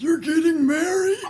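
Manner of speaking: high, loud, overjoyed question; overjoyed squeal. Acted. Friend learning of an engagement, high happy squeal. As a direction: let joy send the voice way up. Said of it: "your voice went up so many octaves".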